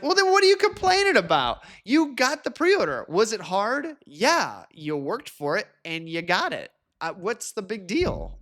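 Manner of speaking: high-pitched incredulous pushback; baffled, animated scolding; exasperated disbelief. Acted. Exasperated imagined reply to complainers.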